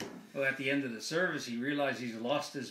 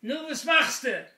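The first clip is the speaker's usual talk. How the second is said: high, loud parrot squawking a greeting; talking parrot; 11 semitones higher, 9 dB louder than usual. Acted. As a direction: call out in Yiddish as a parrot. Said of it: "a parrot that says to him".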